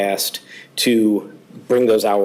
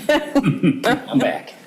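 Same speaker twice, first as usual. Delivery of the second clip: laughing; 10 semitones higher than usual. Real, not acted. Chief jokes returning to podium.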